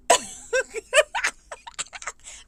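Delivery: high burst of laughter. Real, not acted. Laughs at her own story.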